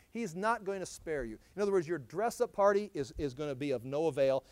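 ordinary talk, nothing marked

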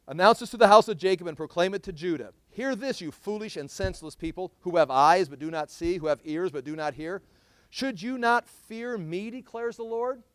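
loud, ringing preacher's reading of the prophet; scripture reading. Acted. Preacher reads Jeremiah aloud to his church, ringing proclamation.

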